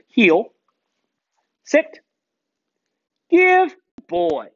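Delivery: training commands; dog commands. Real, not acted. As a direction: call out commands crisply.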